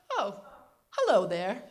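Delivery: loud, mock-gracious New England greeting; New England accent. Grandmother seeing grandfather pantsless, gracious New England accent. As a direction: greet him in a regal, drawn-out New England voice. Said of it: "New England Katharine Hepburn accent".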